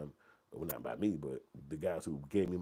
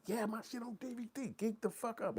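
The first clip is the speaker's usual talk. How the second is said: giddy excitement; 3 dB quieter than usual. Retold or imitated.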